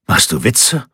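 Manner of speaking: a mildly startled question; lightly surprised disbelief, slightly lifted. A narrator's reading. Cosimo hears of Lorenzo's army, mildly surprised. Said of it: "fragte Cosimo überrascht".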